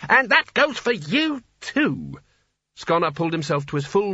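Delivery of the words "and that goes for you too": high, slow, emphatic scolding; angry rebuke. Acted. Sconner rounds on another wizard, angry. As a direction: hit it high, then drop on TOO.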